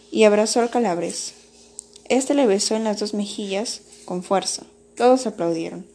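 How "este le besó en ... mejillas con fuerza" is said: usual speaking voice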